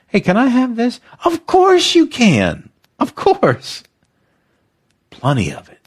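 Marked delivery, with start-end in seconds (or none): 0.1-1.0 high eager asking voice; like a kid asking a best friend. Pretend. Friend asking for something, high and eager. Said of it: "what words I hear". 1.2-3.8 high, slow, delighted yes; generous reply. Pretend. Best friend answering gladly, high and slow. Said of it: "what this best friend love sounds like".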